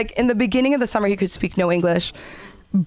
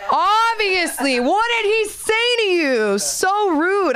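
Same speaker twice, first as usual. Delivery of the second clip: high, slow mock outrage; mock indignant; 10 semitones higher, much slower than usual. Comedian backing up the old woman, slow high mock indignation. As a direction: gasp out the outrage, pitched high. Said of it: "I start clapping".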